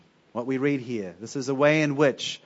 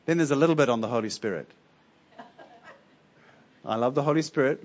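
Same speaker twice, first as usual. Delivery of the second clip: usual speaking voice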